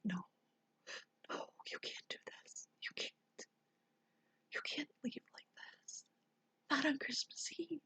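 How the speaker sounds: desperate, unvoiced pleading to him; whispered plea. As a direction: beg him in a whisper so the kids won't wake. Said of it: "I didn't want to wake up my kids".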